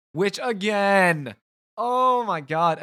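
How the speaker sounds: high, loud, slow fed-up cry; real annoyance at the software, loud and stretched; exasperated groan. Real, not acted. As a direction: drag it out like you can't believe it. Ranting about the broken recording.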